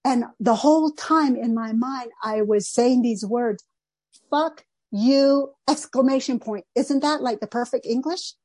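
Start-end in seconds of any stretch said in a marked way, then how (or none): 4.3-5.6 mildly indignant, each word set apart; a touch of fury, punched out word by word; retold rage. Replaying the silent comeback to her, mildly enraged. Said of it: "filled with a rage".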